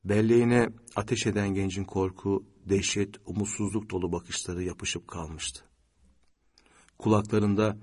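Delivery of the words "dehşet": usual speaking voice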